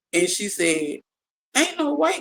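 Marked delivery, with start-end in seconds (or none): 1.6-2.2 doubting grandma. Retold or imitated.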